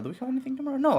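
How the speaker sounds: usual speaking voice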